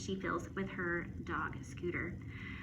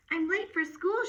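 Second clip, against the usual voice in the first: higher, louder, rushing out the door; a girl late for school; hurried girl; 8 semitones higher, 5 dB louder than usual. A narrator's reading. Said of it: "she said".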